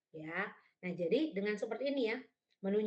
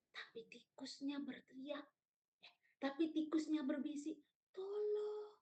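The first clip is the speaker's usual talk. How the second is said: hushed, higher tiny mouse voice; whispering; 6 semitones higher, 7 dB quieter than usual. Shown as a demonstration. Teacher demonstrating the mouse's plea, soft whispering. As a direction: drop to a soft, high whisper. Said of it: "Lalu berbisik itu misalnya".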